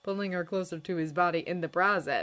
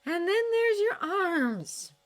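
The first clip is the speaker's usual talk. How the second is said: slow, thoughtful higher voice; musing Astrid; 5 dB louder, much slower than usual. A narrator's reading. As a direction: muse over his form. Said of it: "she mused".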